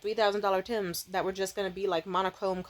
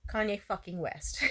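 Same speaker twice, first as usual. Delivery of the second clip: ordinary talk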